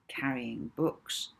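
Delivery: ordinary delivery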